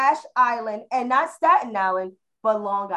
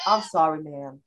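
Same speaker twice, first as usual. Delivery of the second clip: Southern drawl; 8 semitones lower than usual. Retold or imitated. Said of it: "I like his voice".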